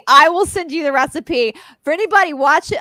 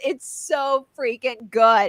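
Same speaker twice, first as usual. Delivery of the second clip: ordinary talk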